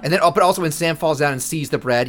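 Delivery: ordinary talk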